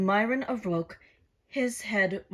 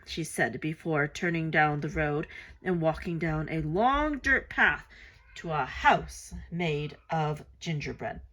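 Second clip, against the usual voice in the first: ordinary delivery, nothing marked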